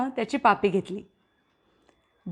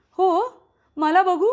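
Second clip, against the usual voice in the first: excited call; 5 semitones higher, 3 dB louder than usual. Acted. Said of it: "Nikita ओरडली".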